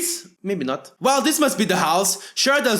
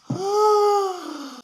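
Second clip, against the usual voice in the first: sharp high gasp jolting awake; startled gasp; 9 semitones higher than usual. Acted. Woken by his mom yelling, a high startled gasp. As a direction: gasp as if yanked out of sleep.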